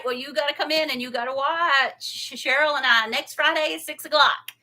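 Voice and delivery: ordinary talk, nothing marked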